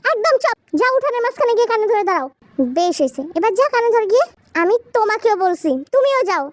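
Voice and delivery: high, insistent commanding voice; stern grandparent orders. Acted. Grandparent sending both women to the yard, stern and insistent. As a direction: order them out firmly.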